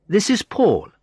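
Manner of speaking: high, lilting woman's voice; friendly Sandra. A narrator's reading. Sandra explains her curiosity to the visitor, friendly. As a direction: explain yourself lightly in a high voice.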